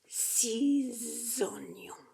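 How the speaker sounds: "Six oignons" very slow, "six" linked with a Z sound; Z liaison in six. Shown as a demonstration. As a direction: stretch "six" and buzz a Z into "oignons". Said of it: "this one's like a Z".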